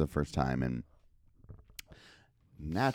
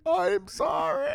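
high, slow, shouted sorry; shouted apology; slower than usual. Retold or imitated. Apologizing to the girl from afar, high slow shout. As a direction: call out an apology across the camp. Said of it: "And I'm like".

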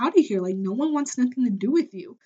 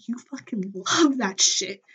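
ordinary talk

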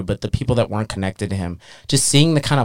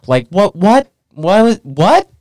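high, loud disbelieving "what?"; incredulous; 11 semitones higher, 6 dB louder, slower than usual. Acted. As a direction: splutter it louder and higher, baffled. Incredulous at the absurd plan.

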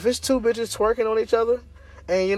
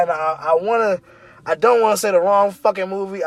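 ordinary talk

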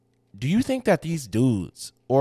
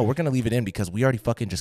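usual speaking voice

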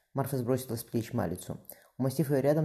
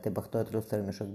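plain reading voice

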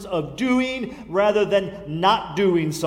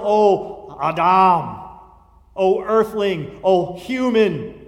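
slow solemn address; 4 dB louder, slower than usual. Acted. Preacher rolling out solemn names.

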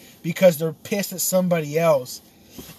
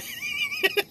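high, helpless giggle; laugh; 3 dB quieter than usual. Real, not acted. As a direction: giggle at your own mistake. Amused at own botched accent.

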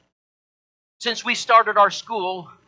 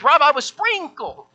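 put-on earnest old lady; 9 semitones higher, 5 dB louder, slower than usual. [name] tells Rob earnestly.

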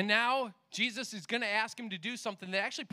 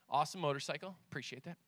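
low, soft offhand aside; quietly distracted by something passing; 7 semitones lower, 6 dB quieter, faster than usual. Real, not acted. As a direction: drop the voice for a side remark.

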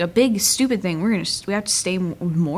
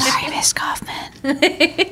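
slow breathy mock apology; giggly prank whisper; 7 semitones higher, much slower than usual. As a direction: whisper it slowly into the mic. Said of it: "Stop whispering into the mic".

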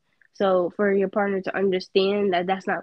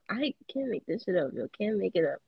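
normal talking voice

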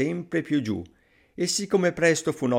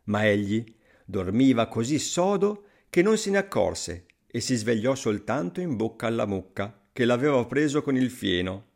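ordinary delivery, nothing marked

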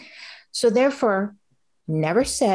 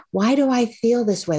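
ordinary delivery

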